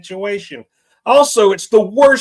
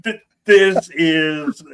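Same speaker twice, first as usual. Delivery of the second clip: ordinary delivery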